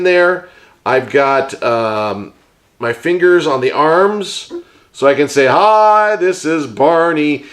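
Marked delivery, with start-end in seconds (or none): 5.5-7.4 starts high on Hi, then slow; a bright purple dinosaur introducing himself; playful Barney puppet voice. Pretend. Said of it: "is talking like this".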